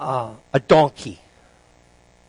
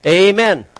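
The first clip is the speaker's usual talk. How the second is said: raised, drawn-out halt command; loud, higher call; 4 semitones higher, 6 dB louder than usual. Acted. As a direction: call out to rein the donkey in. Rider commands donkey to stop.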